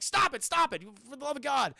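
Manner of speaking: high, loud, repeated protest; acted frantic plea. Passenger hitting the driver, begging him to stop, frantic.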